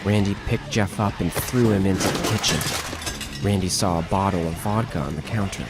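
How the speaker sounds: normal talking voice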